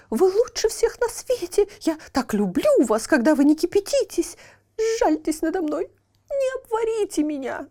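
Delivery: half-chick begging the boiling water for mercy; frantic begging. Acted. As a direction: plead and flatter the water in panic.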